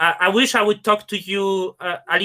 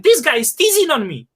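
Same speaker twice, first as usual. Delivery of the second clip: higher-pitched retold incredulity; mock disbelief; 6 semitones higher, 3 dB louder than usual. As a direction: replay your baffled reaction, pitched up. Retelling his disbelief at the offer.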